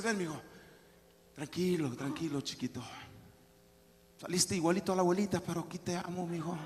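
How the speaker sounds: low, hushed, tender comforting; calm a crying child very softly; soothing father. Acted. Papá drawing near his crying child, soft soothing. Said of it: "el papá se acerca y dice".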